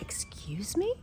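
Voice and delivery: whispered, incredulous question; baffled whisper. Retold or imitated. Woman answers man's garbled line, bewildered whisper. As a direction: breathe it out as a puzzled whisper.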